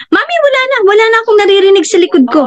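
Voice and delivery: high, fast imitation of a little child; child's voice. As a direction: quote the kid brightly and quickly. Child telling mum breathing cleared.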